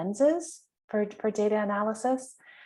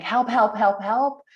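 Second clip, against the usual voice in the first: louder, repeated pleading "help"; half-joking cries for help, a notch louder; mock frustrated yell; 2 semitones higher, 5 dB louder than usual. Retelling a chat with a useless bot, mock exasperated yell. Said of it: "I'm saying".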